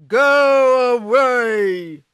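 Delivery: slow, very loud ogre roar; ogre shout. Acted. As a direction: bellow it out as the angry ogre.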